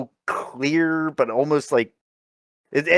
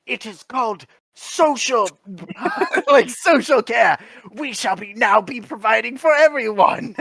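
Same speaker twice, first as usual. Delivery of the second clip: mock proclamation; 7 semitones higher than usual. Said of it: "he's like".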